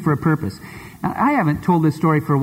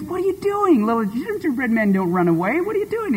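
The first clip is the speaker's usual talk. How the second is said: much higher, pleading cries; panicked girl voice, much higher; alarmed little girl; 8 semitones higher than usual. Acted. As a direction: squeal after the runaway. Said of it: "She goes".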